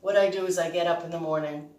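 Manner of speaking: ordinary talk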